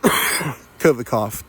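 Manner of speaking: covid cough spoken slowly through a cough. Pretend. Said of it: "it's not a covid cough".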